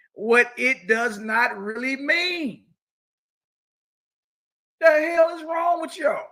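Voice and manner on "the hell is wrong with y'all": loud, high outraged yell; high-pitched furious rebuke; exasperated shout. Real, not acted. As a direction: blast them with disbelief. Preacher scolding his opponents.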